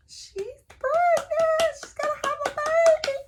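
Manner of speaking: very high, drawn-out singsong reveal; gleeful squeal. Real, not acted. Revealing Josie's pregnancy to viewers, gleeful squeal. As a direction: squeal the big news in a tiny high voice.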